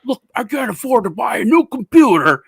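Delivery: loud, high imitation of an irate husband; mock angry yelling. Old husband yelling at Microsoft support, imitated as irate. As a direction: yell the complaint up high, put on. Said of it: "dude's yelling at me".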